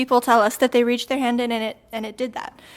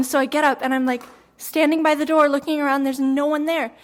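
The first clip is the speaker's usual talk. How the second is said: usual speaking voice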